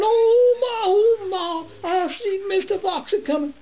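put-on young rabbit calling Ma in alarm; little rabbit hollering. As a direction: shout it like a small boy running in. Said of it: "a running and a hollering".

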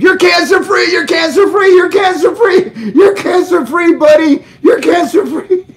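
screaming with joy. Retold or imitated. Doctor friend Mike shouting the good news, ecstatic scream. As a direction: scream it out like the best news ever. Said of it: "He screamed".

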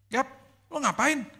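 high surprised call across the street; friend calling out. Retold or imitated. Friend spotting his wife on a Singapore street, surprised high call.

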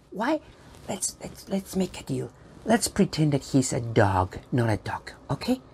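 soft, secretive aside; hushed confiding. Pretend. Presenter plotting with children behind the puppet's back, hushed. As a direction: drop to a near whisper.

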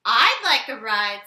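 a loud, bold cat. Acted. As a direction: call out like a big cat. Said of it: "said the cat".

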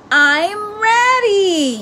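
a small frog bursting to go, yelling it out; eager little frog yell. A narrator's reading. Froggy shouting back to his father, high eager yell. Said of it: "yelled Froggy".

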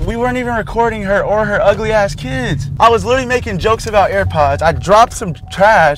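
high, loud exasperated venting; indignant protest. Real, not acted. Man venting to his friend after being accused, indignant protest.

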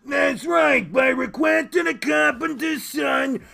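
high, shouted character voice; boisterous, pushed-up host voice; Pops. Acted. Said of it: "Right, Pops?".

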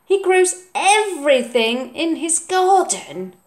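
put-on proud child voice; proud boy. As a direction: boast about Grandpa's garden. Oliver's voice.

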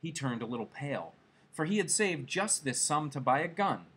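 usual speaking voice

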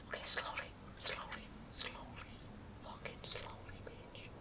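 hushed whisper, holding breath; tense whisper. Real, not acted. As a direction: whisper it carefully, barely breathing. Careful tense moment in game.

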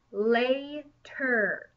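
"later" stretched very slowly, syllable by syllable; slowly sounded-out "later" for first graders; slow syllable demo. Shown as a demonstration. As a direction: draw out "later" so kids hear the two parts. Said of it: "And there's our E R again".

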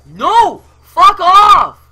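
two very high, very loud bursts; furious scream. Real, not acted. Gamer fending off an opponent, furious scream. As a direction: scream them away in real rage.

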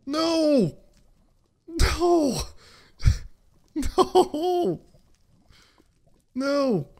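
high, loud wail of dismay; anguished cry. Real, not acted. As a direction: howl at the lost loot. Loot lost in lava.

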